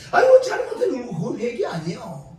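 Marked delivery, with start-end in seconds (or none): 0.1-0.8 high, loud, laughing fake lament; mock wailing repentance. Preacher mocking the weeping kind of repentance with a loud, high fake wail. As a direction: parody a tearful confession. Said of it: "울고불고".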